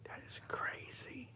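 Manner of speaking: slow awed whisper. Real, not acted. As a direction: breathe it, stunned and hushed. Staring at shadow in dark.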